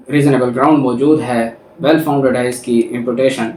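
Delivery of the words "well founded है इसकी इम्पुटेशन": ordinary talk, nothing marked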